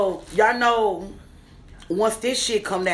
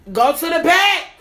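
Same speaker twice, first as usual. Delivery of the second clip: loud; 8 semitones higher, 6 dB louder than usual. Real, not acted. Pointing out card details.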